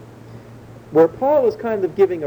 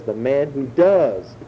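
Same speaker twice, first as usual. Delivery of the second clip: DOES drawn out slowly; stress on DOES; slower than usual. Shown as a demonstration. Preacher quotes Leviticus to show the law is doing, stress on DOES. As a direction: land hard on "does", stretching it. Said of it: "emphasis on that word".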